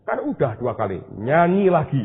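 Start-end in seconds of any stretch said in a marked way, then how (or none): 1.2-2.0 insistent repeat. Retold or imitated.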